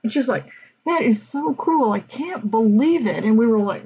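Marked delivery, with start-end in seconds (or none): 0.8-3.2 high, indignant squeal of disbelief; mock outrage. Theater teacher scolding her colleagues, high and indignant. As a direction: ham up her shock. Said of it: "she was just outraged".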